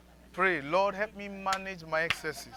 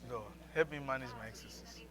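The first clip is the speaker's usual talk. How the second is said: softer, lower plea; quiet prayer; 3 semitones lower, 8 dB quieter than usual. Shown as a demonstration. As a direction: pray it under your breath.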